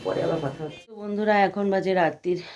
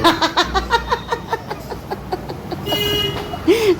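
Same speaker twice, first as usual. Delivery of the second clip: real delighted laugh; long high laugh; 14 semitones higher, 3 dB louder than usual. Real, not acted. Laughing at husband's remark.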